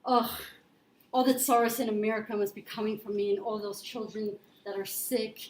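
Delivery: usual speaking voice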